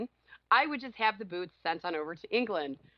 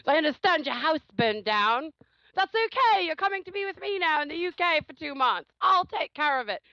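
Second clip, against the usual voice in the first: mock boyfriend reasoning; 7 semitones higher, 4 dB louder than usual.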